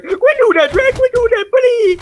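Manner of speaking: very high, loud squealing Donkey; panicked Donkey. Retold or imitated. Donkey yelling at Shrek over lava, high shriek. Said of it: "He's like".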